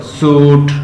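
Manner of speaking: "सूट" drawn out slowly on the long oo; slow down and stretch "सूट"; teacher stretching "सूट"; stretched vowel word. Shown as a demonstration. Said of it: "back of tongue से आ रही है".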